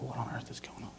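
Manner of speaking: very soft whisper, as if thinking it; bewildered whisper under the breath; whispered aside. Retold or imitated. Fred noticing his seatmate's twitch, whispered aside.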